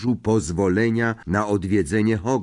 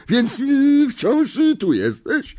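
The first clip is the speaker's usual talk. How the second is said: put-on high, loud, overbearing old aunt; a big domineering aunt who loves her dog; bossy aunt; 3 dB louder, slower than usual. A narrator's reading. Marge to Harry. (władcza ciotka, wysoki głos)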